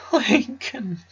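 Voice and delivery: high, choked cry of a name; a grieving father sobbing out the boy's name. A narrator's reading. The husband cries out Lincoln's name in grief. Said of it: "Howard cried".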